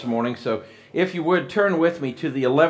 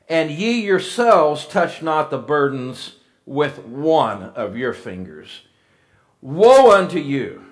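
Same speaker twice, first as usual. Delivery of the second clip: loud, raised scripture proclamation; preaching at full force; 3 semitones higher, 5 dB louder than usual. Acted. Preacher reading Jesus' woes to the congregation, loud and raised. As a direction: declaim it like a fiery preacher.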